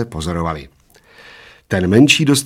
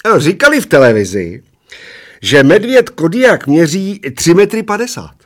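high-pitched little man's yell; restrained yell; 8 semitones higher, 6 dB louder than usual. A narrator's reading. Little old man to the bald one, restrained high yell. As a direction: yell it high, without going all out. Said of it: "relativně tiše zařval".

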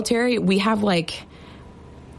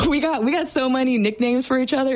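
laughing; 5 semitones higher than usual. Real, not acted. Laughing about nicknames.

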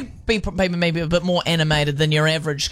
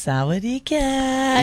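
polite Thai greeting; 6 semitones higher, much slower than usual. Retold or imitated. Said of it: "when they're being polite".

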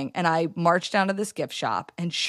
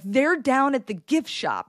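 all excited; 6 semitones higher, slower than usual. Retold or imitated. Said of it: "all excited".

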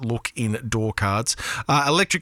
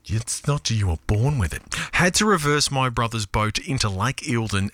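ordinary talk, nothing marked

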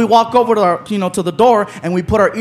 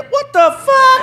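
high-pitched, drawn-out mock disbelief; voice suddenly changes; 13 semitones higher than usual. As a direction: break into a slow, high cry of indignation. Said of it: "voice changed".

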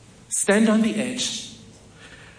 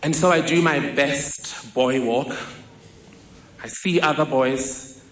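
normal talking voice

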